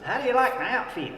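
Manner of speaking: mock proud boss showing off his outfit; proud boast. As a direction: strut it like a proud golfer. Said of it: "burst out of there and says".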